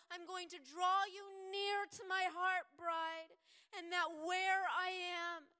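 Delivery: Jesus promising his bride he will return; impassioned promise. Acted.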